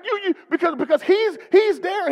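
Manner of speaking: normal talking voice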